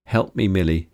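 plain reading voice

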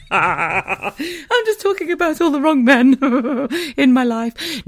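high, slow, breaking up with laughter; laughing. Real, not acted. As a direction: squeeze the words out through laughs. Laughing at her own confession.

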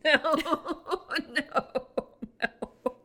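helpless laughter through the word no; laughing disbelief. Real, not acted. Co-host hearing the absurd ending, laughing in disbelief. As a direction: laugh out a high, drawn-out refusal.

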